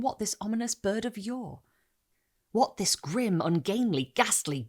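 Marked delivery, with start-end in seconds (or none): none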